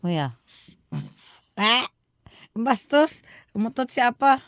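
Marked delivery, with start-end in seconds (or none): 1.5-4.4 startled outcry. Real, not acted. Reacting to grandpa's fart.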